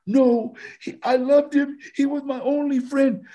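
high-pitched pleading denial; acted grief. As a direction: act out a grieving girlfriend protesting. Acts grieving girlfriend's answer in scene.

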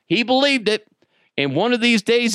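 ordinary delivery

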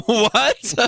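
ordinary delivery

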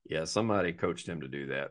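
low, quiet knowing aside. Acted. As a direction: say it under your breath, like a private verdict. Muttered reaction while reading answer.

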